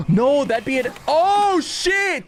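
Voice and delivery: high, loud sudden eureka; excited outburst. Real, not acted. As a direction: blurt it as the idea hits.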